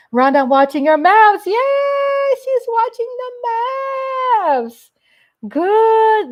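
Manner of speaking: loud, high, stretched yay; happy cheer. Real, not acted. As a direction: cheer her on for watching the game. Loud cheer reading a message.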